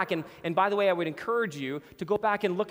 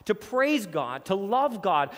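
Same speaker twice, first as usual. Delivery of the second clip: usual speaking voice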